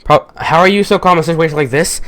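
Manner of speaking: usual speaking voice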